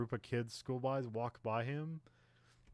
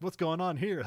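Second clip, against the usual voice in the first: mock clueless tourist; 7 semitones higher, 6 dB louder than usual. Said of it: "They're like".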